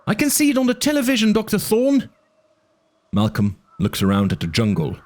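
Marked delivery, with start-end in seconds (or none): 0.1-2.1 put-on very high urgent boy voice; kid on radio. A narrator's reading. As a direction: plead over the radio, much higher. Arby voice over radio.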